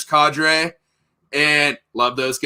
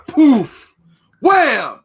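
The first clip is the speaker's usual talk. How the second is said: loud, high-pitched bang noises; imitated gunshot; 9 semitones higher, 7 dB louder than usual. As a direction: punch out each impact sound.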